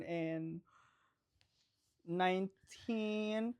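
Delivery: very slow, stretched words; slow, measured announcement; deliberate, drawn out. Real, not acted. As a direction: spell out the year slowly and softly. Stretching out birth year playfully.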